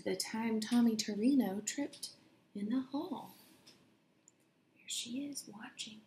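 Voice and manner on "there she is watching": hushed aside. Acted. Reader's hushed aside about Sally.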